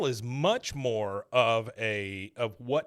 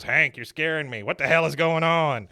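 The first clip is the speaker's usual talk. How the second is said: louder, alarmed neighbour; mock alarmed Dale; 4 semitones higher, 7 dB louder than usual. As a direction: half-shout the worry.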